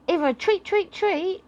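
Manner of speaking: high, excited little dog asking for a treat; the dog begs for treats, voice way up; talking dog wants a treat; eager dog voice. Retold or imitated. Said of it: "your dog starts going".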